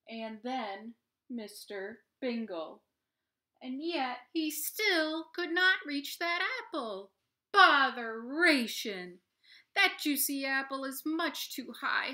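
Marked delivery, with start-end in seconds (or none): 3.6-7.1 building refrain. A narrator's reading. 7.5-12.1 high, loud exasperated cry of botheration; frustrated Mister Bingle. Acted. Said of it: "Mister Bingle's lament".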